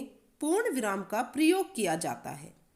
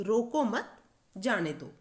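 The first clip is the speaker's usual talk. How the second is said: रोको मत in one go, slight stop, जाने दो; pause after मत. Shown as a demonstration. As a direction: break the phrase right after मत. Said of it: "रोको मत के बाद थोड़ा सा रुके". (मत के बाद थोड़ा रुककर)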